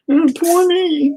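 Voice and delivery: high, slow mocking whine of self-pity; mock whiner. Mocking himself for whining, high slow whine. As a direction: sneer it out high and drawn like a crybaby. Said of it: "whining and bitching like".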